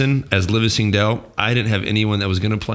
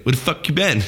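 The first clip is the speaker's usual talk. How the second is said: higher-pitched quoted movie line; mildly acted-out question, pitched up; imitated film line; 6 semitones higher than usual. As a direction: toss out a light imitation of the character. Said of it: "You know, like that".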